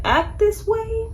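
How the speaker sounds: sarcastic high question; incredulous. Real, not acted. Creator to viewers, a pitch leap of disbelief. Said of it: "incredulous rising question".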